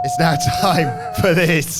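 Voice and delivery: loud, high, slow announcement; segment announcer. Pretend. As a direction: announce it big and drawn out.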